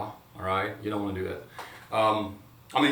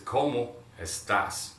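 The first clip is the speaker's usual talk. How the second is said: "¿Cómo estás?" said slowly and clearly; slow model; slower than usual. Shown as a demonstration. Teacher slowly models "¿Cómo estás?" for students. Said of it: "Repeat after me".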